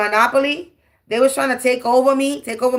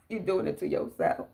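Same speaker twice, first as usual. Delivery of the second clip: whisper; 13 dB quieter than usual. Real, not acted. Repeated line, dropped to whisper.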